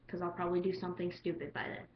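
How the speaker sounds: normal talking voice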